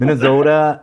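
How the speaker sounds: hammed-up Minnesota accent on Minnesota. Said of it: "That sounds more like Arnold Schwarzenegger".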